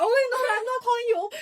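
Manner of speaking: high, loud flustered backtrack; character apologising to her boss, high and flustered; mock panicked backpedal. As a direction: scramble to take it back. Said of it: "She's like".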